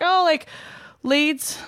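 high, put-on British accent. Telling the merch girl a hometown, high put-on British accent. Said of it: "my British accent just turned on".

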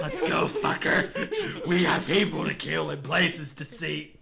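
high, loud laughing whoop; rallying cry. Acted. Player rallying party to kill, loud laughing cry. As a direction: holler it through laughter, hammed up.

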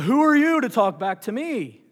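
a loud, lightly voiced booming God; mock divine rebuke, half-hearted; James Earl Jones voice. God scolding Moses in a loud mock James Earl Jones voice. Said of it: "I don't have a deep voice. It doesn't work".

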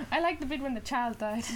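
a laughing, lightly voiced impression of a mum; impression of friend's mum. Teasing a friend by imitating her mum, laughing. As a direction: giggle through a cheery mum voice. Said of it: "That's my impression of your mum".

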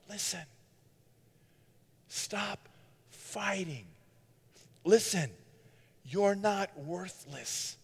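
soft, slow, gentle pleading; a quiet altar-call invitation; tender appeal. Acted. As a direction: hush down and plead with them gently.

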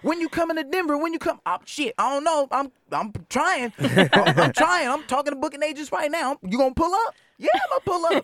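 hammed-up back-and-forth, high and bright in pitch; mock excited chat. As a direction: play an eager fan and a hyped artist trading messages. Acts out fan DM exchange.

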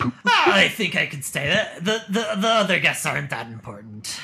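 charmed gruff mayor, loud; delighted gravelly old man. Acted. NPC charmed by cleavage.

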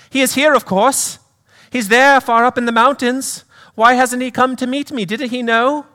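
very high, insistent British lady; British accent. Acted. Mother asking after her son, high British accent. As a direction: push a British accent up into a woman's voice. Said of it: "do a little accent work".

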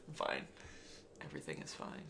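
very low, hushed deadpan; mock composure. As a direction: drop right down, soft and controlled. Mock-denying being manic, after laughing.

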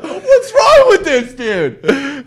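laughing disbelief. Retold or imitated.

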